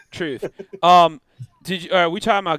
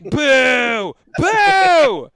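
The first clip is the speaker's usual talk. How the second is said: loud booing, rising higher on the second; 7 semitones higher, 8 dB louder than usual. Retold or imitated. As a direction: boo the screen like a heckler in the crowd. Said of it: "And I'm like".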